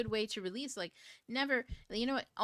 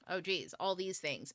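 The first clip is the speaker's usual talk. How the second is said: ordinary talk, nothing marked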